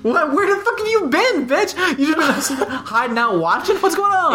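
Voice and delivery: high, squealing fake outrage; mock shock. Mitch shocked to see Angie back, high mock outrage. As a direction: play up the stunned boyfriend.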